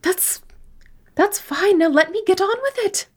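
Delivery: Missus Paisley voice. Acted. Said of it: "I don't know what Missus Paisley's accent is anymore".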